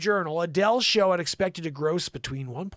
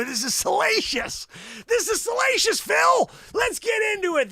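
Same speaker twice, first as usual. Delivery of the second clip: hyped-up gossip; 12 semitones higher than usual. Acted. Host hyping Phil's salacious story, high excited shout. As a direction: shout it like the juiciest scandal.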